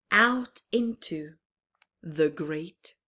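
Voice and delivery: usual speaking voice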